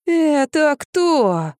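high, very drawn-out whimper; plaintive. A narrator's reading. Drunk man asks the woman who that is, plaintively. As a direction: ask pitifully, stretching it high and slow. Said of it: "и жалобно спросил".

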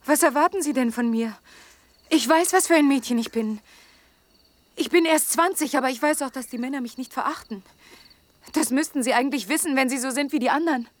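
loud, high, rushing protest; emotional outburst. Acted. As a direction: let it all burst out. Emotional outburst about herself. (lauter, erregter Ausbruch)

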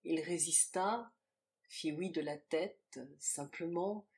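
ordinary delivery

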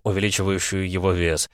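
usual speaking voice

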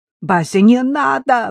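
high, loud plea; urgent pleading cry; imploring. A narrator's reading. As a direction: beg her to stop. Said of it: "взмолилась я".

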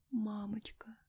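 high, tiny whisper; faint, fragile plea; barely audible call. A narrator's reading. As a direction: call softly, lips barely moving. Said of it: "Едва шевеля губами, позвала её".

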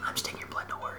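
soft hushed whisper. Retold or imitated. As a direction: whisper gently so as not to wake. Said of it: "I was like".

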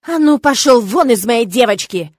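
loud, furious father's roar. Acted. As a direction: roar him out of the room. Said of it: "От грозного рыка отца".

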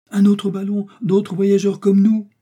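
high, loud, drawn-out wonder; amazed announcement. Acted. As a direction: proclaim an astonishing sighting. Amazed at seeing another balloon.

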